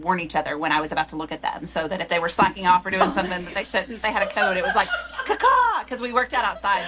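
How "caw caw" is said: high, loud crow caw; bird call. Retold or imitated. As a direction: squawk like a crow warning. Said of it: "they would make a bird noise".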